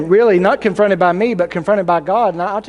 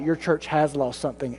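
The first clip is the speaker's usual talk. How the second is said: lower, much softer echo from God; a hushed, lower divine reply; 4 semitones lower, 8 dB quieter than usual. Acted. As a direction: turn the complaint back quietly and lower. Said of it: "and God responds".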